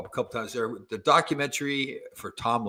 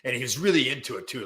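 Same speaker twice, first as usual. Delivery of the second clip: normal talking voice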